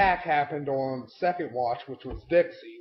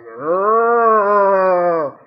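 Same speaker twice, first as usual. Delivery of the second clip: loud, far-off moaning groan; cultist groan; 2 semitones higher, 9 dB louder than usual. Retold or imitated. Mocking cloaked cultists with a loud groan. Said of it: "They're all like".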